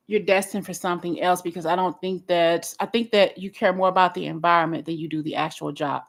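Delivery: ordinary talk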